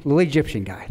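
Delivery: ordinary delivery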